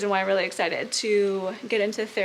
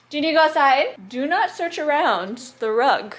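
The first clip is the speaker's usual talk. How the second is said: high, louder pet-owner voice; real scolding aimed at a pet; talking to dog; 5 semitones higher, 6 dB louder than usual. Real, not acted. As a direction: warn the dog off in a raised pitch.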